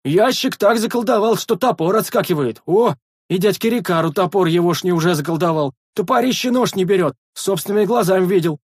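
high, excited calling; eager loud gossip. A narrator's reading. Sentry gossiping across the wall, eager and loud. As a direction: spread the news for all. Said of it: "охотно поведал первый часовой во всеуслышание".

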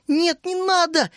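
high, drawn-out tearful protest; tearful plea. A narrator's reading. Вишня clutching Тереза's sleeve to stop her, tearful plea. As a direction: plead slowly in a high voice. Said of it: "Вишня со слезами схватила её".

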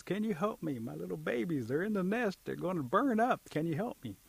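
high-pitched storybook plea; desperate mother bird. Acted. Mother bird begging help for her babies, desperate.

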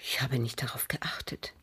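hushed, evasive whisper. Acted. Widow whispering evasively to the investigator. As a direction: barely breathe the answer. Widow evading questioner's probing.

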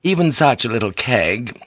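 ordinary delivery

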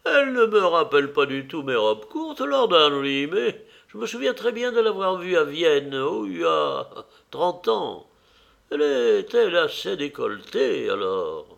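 high-pitched society lady; teasing old lady, high voice; lively old hostess. Acted.

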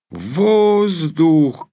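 high alarmed shout of warning. A narrator's reading. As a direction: yell it to warn of planes. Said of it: "крикнул круглоголовый боец". (тревожный крик)

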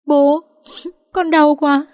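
high-pitched tearful whimper, acted. A narrator's reading. Dương hides behind her father, whimpering. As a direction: whimper it like a hurt child seeking protection. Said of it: "Con Dương thì nép phía sau thút thít".

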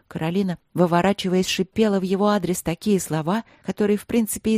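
ordinary delivery

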